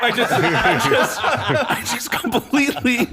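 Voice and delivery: very high, slow, laughing words; giddy laughter. Retold or imitated. Retelling meeting his hero, high giddy laughing. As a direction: giggle helplessly. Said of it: "like, lost it".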